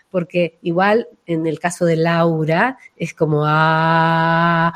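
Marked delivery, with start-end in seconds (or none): 3.4-4.7 low resonant heart Ah; heart-centred ah. Shown as a demonstration. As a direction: let a deep Ah come from the chest. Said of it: "una A realmente de corazón".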